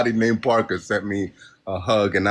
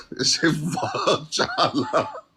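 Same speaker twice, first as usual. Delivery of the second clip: laughing; 5 semitones higher, 4 dB quieter, much slower than usual. Real, not acted. Laughing at friend's microchip joke.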